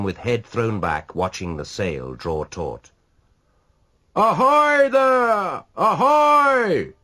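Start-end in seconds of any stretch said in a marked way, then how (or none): 4.1-6.9 high, loud, long drawn-out hail; hailing yell. A narrator's reading. Rashleigh hailing the passing ship, loud drawn-out yell. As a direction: hail the ship across the water. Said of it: "yelled Rashleigh".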